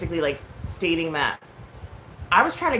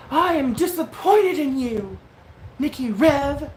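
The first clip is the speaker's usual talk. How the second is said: high, loud mocking imitation; mock scolding; 9 semitones higher, 6 dB louder, slower than usual. Streamer scolding the speaker, exaggerated disappointment. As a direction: stretch it out like an overblown telling-off.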